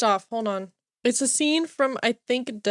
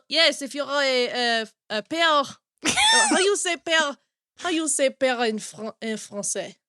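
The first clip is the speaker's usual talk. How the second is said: halting put-on French accent; 3 semitones higher than usual. Host asking about a word, mock French accent. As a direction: fumble for words in a French accent. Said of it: "I started with this French accent".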